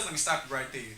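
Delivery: usual speaking voice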